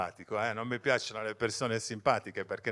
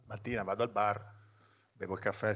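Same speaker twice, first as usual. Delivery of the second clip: ordinary delivery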